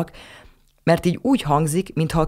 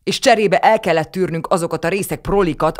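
louder, bitter and indignant; angry retort; 4 semitones higher, 4 dB louder than usual. A narrator's reading. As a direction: hit back hard at the in-law. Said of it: "csattant fel Tibor papa".

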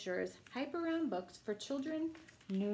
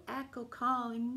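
gentle bedtime story reading; reading to children; slower than usual. Acted. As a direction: bedtime picture book read-aloud. Reading picture book aloud.

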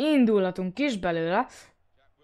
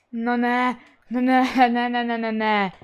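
dismayed; 3 semitones higher, 7 dB louder than usual. Real, not acted. Despair after the miss.

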